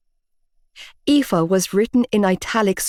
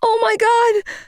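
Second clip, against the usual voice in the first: scream. A narrator's reading. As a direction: shriek in horror. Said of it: "I screamed".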